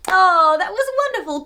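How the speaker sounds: ordinary delivery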